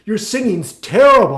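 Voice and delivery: loud, slow verdict through laughter; amused mockery. Friend teasing the singer, laughing bluntness. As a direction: laugh his name, then stretch out the insult. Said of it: "And he said".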